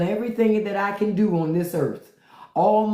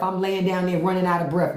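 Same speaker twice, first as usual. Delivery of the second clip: usual speaking voice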